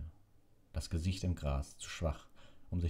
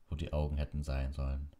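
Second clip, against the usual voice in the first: usual speaking voice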